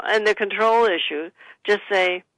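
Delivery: normal talking voice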